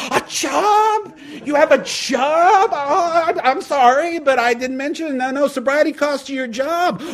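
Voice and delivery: very high, loud fake shock and fake sorry; mock-shocked God. God adding the job to the bill, shouting high. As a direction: gasp at the news, then cheerfully take it. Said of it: "And God goes".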